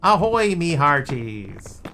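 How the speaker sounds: loud, drawn-out pirate hail. Pretend. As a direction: bellow it like a pirate captain. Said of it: "Sound like a pirate?".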